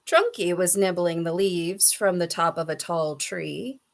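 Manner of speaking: plain reading voice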